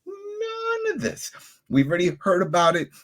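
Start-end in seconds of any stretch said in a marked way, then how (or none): 0.1-1.3 very slow, much higher, softer disbelieving wail; exasperated drawn out. Real, not acted. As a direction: stretch it out in weary disbelief. Ranting repeats his complaint.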